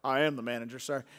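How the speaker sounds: smug cashier reply; deadpan cashier. Retold or imitated. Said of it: "And he goes".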